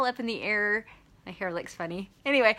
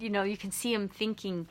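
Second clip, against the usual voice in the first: usual speaking voice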